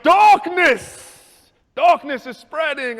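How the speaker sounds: loud, high taunting chant, slow; hammed-up spooky announcement; mocking bullies. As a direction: bullies jeering down the hall. Bullies taunting him in school.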